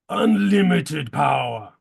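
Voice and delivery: slow, drawn-out movie-villain roar; hammed-up, half-speed evil overlord; villainous power cry. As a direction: stretch it out like a power-mad villain. Movie villain quote, stretched out.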